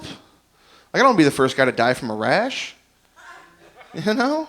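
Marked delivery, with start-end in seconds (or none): none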